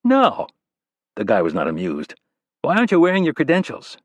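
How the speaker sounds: a prim aide pressing him; annoyed young staffer. Acted. Staffer challenging Rapp.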